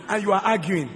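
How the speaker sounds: ordinary talk, nothing marked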